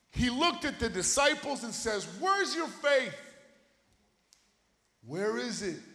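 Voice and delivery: loud, high, impassioned preaching; fired-up sermon climax; full-force preaching. Acted. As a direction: preach it to the back row.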